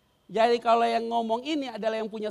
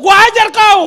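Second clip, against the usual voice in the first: very loud, high threatening yell; imitating a rough exorcist's yell; harsh yell; 13 semitones higher, 21 dB louder, slower than usual. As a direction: scream it at the demon. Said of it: "nggak boleh ditengking keras-keras".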